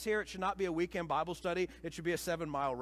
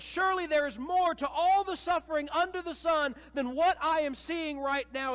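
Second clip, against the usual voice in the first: a desperate heart begging louder and louder; heart crying out; 9 semitones higher, 7 dB louder than usual. Acted. Preacher voicing the listener's heart, building to a loud pleading cry. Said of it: "your heart crying out".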